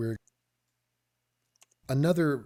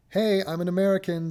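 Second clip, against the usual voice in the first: higher-pitched mock self-introduction; mock announcing; 4 semitones higher, 3 dB louder than usual. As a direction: announce it like your whole identity, mockingly. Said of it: "I'm not sitting there going".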